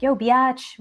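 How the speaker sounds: endearing mock-sassy call-out; playful affectionate teasing. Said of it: "very playfully, very affectionately, very endearingly".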